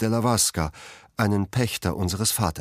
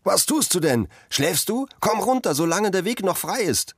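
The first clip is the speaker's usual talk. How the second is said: high, urgent call upward; 11 semitones higher than usual. A narrator's reading. Children urging Cosimo down from the tree, high calls. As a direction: urge him down before it's too late. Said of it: "riefen ihm die anderen zu".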